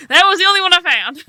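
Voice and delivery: fast, high-pitched defensive protest; panicked fib. Acted. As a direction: blurt out an unconvincing excuse. Caught fumbling a lie.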